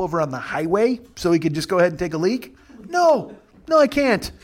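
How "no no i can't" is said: high, indignant retort to a passenger; mock retort. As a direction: tell him off, pitched high and sharp.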